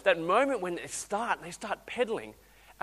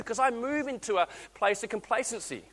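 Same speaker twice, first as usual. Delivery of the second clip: ordinary talk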